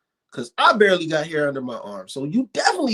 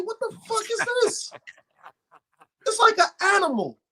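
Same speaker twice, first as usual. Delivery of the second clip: mock disgusted shock. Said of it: "I'm like".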